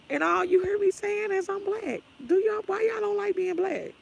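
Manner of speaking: high-pitched exasperated whine; squeaky, baffled protest; exasperated plea. Real, not acted. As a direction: plead in a high, disbelieving voice.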